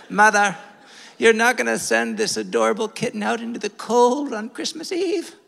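put-on high, pleading little-sister British accent; mock British accent. Sister begging mother to keep the kitten, mock British accent. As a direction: put on a posh British plea. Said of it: "she developed a British accent at this point".

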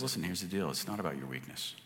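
a touch of a hushed, fatherly God; God speaking. Retold or imitated. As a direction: speak as a gentle God reassuring Moses. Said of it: "And he says".

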